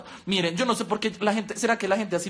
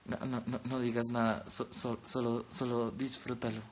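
much lower, much softer crooning voice; 11 semitones lower, 10 dB quieter than usual. Acted. Voicing the man in the video to Luisa, low seductive murmur. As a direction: croon it low and soft like a lover.

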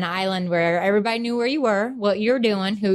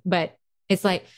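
ordinary talk

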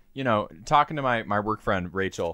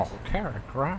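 Jimmy Stewart; 9 dB quieter than usual. Acted. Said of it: "it's fucking Jimmy Stewart talking".

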